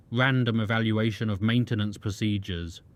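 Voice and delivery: usual speaking voice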